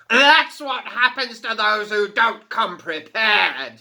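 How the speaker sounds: loud, high, slow scornful boom; mocking fire fiend. A narrator's reading. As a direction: sneer down at the tiny girl.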